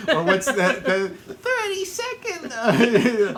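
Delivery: squeaky mock insult voice; shrill cartoon wife taunt; high mocking falsetto.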